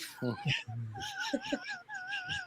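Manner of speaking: very high, soft whimper; high whimpering laugh-cry. Retold or imitated. Contestant's teary reply, high whimpering laugh-cry. Retelling her upset reply.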